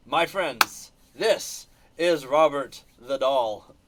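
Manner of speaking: slow, dramatic reveal of a haunted doll; presenting a haunted doll to friends, slow and ominous; ominous intro. Pretend. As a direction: draw it out like a horror trailer.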